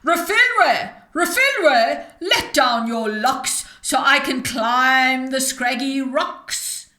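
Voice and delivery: rough witch's yell. Acted. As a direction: yell up the cliff like a coarse old witch. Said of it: "a rough voice close to him yelling".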